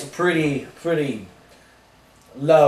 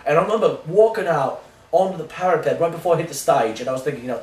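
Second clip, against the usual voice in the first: ordinary delivery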